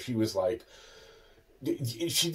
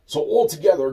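normal talking voice

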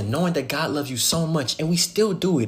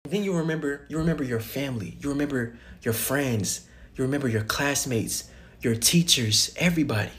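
hushed; 6 dB quieter than usual. Acted. Solemn imagined scene, softened voice.

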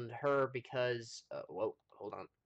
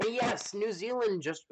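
ordinary talk, nothing marked

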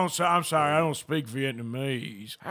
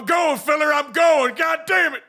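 panicked cowboy shout; 10 semitones higher, 7 dB louder than usual. Acted. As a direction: yell while bolting away. Fleeing hackers in panic.

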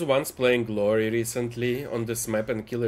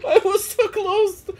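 high laughing wail; laughing despair; 5 dB louder than usual. Real, not acted. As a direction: laugh it out in disbelief. Laughing after being moried.

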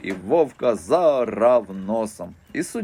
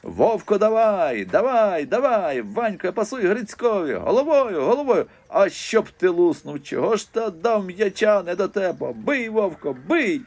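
дід кричить, підбадьорюючи; 9 semitones higher than usual. A narrator's reading. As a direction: yell at the players like a fired-up old fan. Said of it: "Дід Салимон не змовкав ні на хвилину". (старий дід галасливо вболіває)

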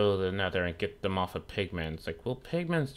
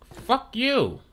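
very high, loud outburst; angry yell; 5 dB louder than usual. Real, not acted. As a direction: yell it at the enemy, pitched way up. Yelling at teleporting Enderman.

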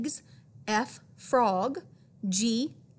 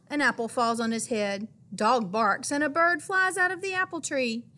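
ordinary delivery

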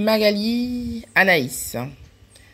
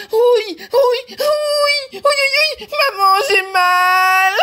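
much higher, louder whiny cry; child wailing in pain; 5 dB louder than usual. Acted. Jonathan crying to his mother after losing a tooth. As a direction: wail like a hurt little boy. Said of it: "Il hurla de douleur".

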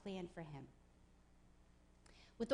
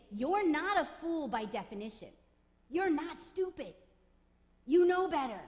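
rising rebuke; 4 semitones higher, 6 dB louder than usual. Retold or imitated. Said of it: "what he's saying is".

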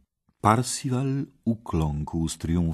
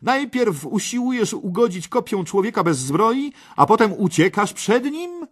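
very high, loud jeer; taunting shout; 7 dB louder than usual. Acted. Parsival mocks the red knight, shouting high. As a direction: hurl insults mockingly at a coward. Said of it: "krzycząc urągliwie".

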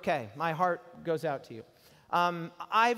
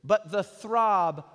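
slow loud declaiming; 3 semitones higher, 7 dB louder, much slower than usual. Acted. Preacher declaims a quoted line.

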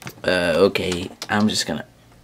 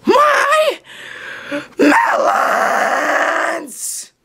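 much higher, cracking, outraged; outraged cry; much slower than usual. Acted. Brother finds his melons eaten, crying out in outrage. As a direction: demand it like your heart is broken.

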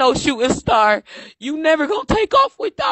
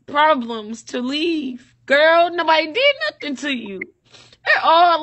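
usual speaking voice

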